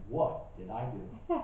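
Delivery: soft, slow, chuckling through it; half laughing at the hurt question; laughing spouse. Retold or imitated. Spouse puzzled by the outburst, laughing quietly. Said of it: "my spouse was wondering".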